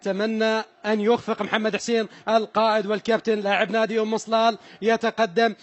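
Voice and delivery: ordinary talk, nothing marked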